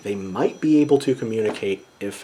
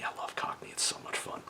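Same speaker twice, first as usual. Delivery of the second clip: soft whisper, half to himself; whispered aside; 16 dB quieter than usual. Real, not acted. Teacher confides his love of Cockney, whispering. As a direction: let it slip out as a fond secret.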